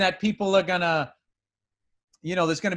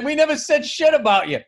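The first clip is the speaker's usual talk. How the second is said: indignant denial; 9 semitones higher, 5 dB louder than usual. Retold or imitated. Hosts deny trashing him.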